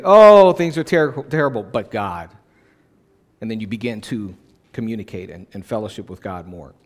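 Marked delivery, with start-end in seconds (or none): 0.0-2.3 hopeful lament. Shown as a demonstration. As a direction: cry out, then turn to hope. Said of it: "It's another thing to say".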